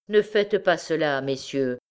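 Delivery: plain reading voice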